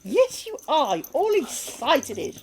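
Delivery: slow, high sing-song pet voice. Real, not acted. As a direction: stretch the words out to the puppy. Cooing at excited dog.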